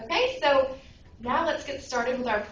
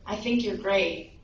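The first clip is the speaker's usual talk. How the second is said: usual speaking voice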